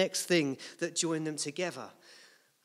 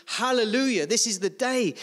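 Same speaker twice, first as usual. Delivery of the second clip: a touch of rousing victory cheer; excited zealot; 3 semitones higher, 6 dB louder than usual. Retold or imitated. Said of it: "I'm sure Simon the Zealot was thinking".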